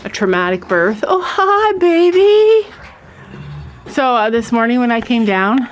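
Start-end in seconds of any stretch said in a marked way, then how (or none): 1.1-2.7 very high, loud cooing pet voice; squealing sweet hello to an animal. Real, not acted. Greeting her horse in the barn.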